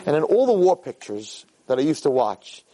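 usual speaking voice

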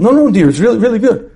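acted husband, high-pitched reassurance; insistent protest. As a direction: hastily insist you really mean it.

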